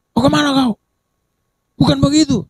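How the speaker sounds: high mock retort in a put-on voice; stubborn retort. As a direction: toss it back like a know-it-all. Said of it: "Dia keras". (membantah dengan nada tinggi)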